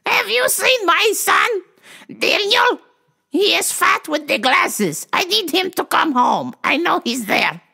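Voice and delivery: put-on high-pitched accented old mom on the phone; shrieky, insistent mock-mom voice; elderly mother caricature. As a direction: imitate a worried mother hunting for her son. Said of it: "she had the historic phone call".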